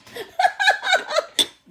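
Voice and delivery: loud, high burst of laughter; high giggle. Real, not acted. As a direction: giggle out loud at your own story. Amused by own retold answer.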